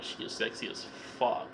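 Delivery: breathless whisper. Real, not acted. Reviewer swooning over the actress, in a whisper. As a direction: hush it out in awe.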